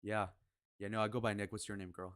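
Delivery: low, soft and smooth; suave pickup demo. Shown as a demonstration. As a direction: demonstrate a cool, suave flirt. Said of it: "Be suave".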